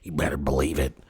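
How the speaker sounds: ordinary talk, nothing marked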